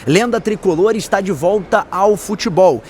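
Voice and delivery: slow, punchy headline announcer read; news headline read. Acted. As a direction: announce the headlines slowly, each one a hook. Headline intro with music.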